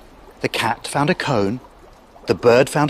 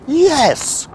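high, glad cry; witch's cry; 13 semitones higher, 4 dB louder than usual. A narrator's reading. Witch welcomes the bird aboard, crying out high. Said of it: "cried the witch".

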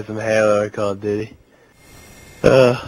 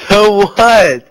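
defiant; 8 semitones higher, 9 dB louder than usual. Real, not acted. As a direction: throw it back as a yell. Defiant retort to being called drunk.

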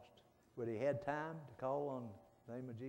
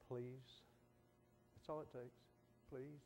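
much softer, begging please; 13 dB quieter, slower than usual. Retold or imitated. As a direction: plead quietly to God. Pleading prayer voiced.

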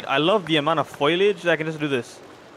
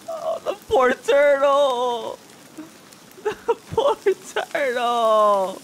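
slow, high, drawn-out wail; mock wailing; 7 semitones higher, 3 dB louder, slower than usual. Player lamenting the poor turtle, mock wailing. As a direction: wail it long, close to laughing.

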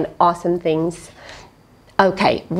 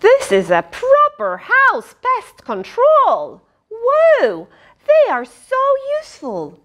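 much higher, excited marvelling; wide-eyed amazement; amazed. Acted. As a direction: gush over it in wonder. Reader marvels at spiders.

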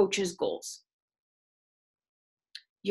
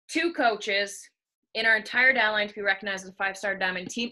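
ordinary talk, nothing marked

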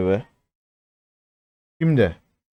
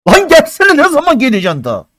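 high, loud yell, then faster; impatient yell; 12 semitones higher, 4 dB louder than usual. Real, not acted. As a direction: shout at the game to give it up. Begging the drop to come.

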